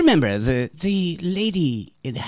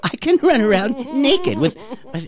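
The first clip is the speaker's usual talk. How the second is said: giggling higher through the line; laughing; 8 semitones higher, 4 dB louder than usual. Acted. Bunny brags about running naked, giggling and higher. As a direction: laugh while bragging, higher.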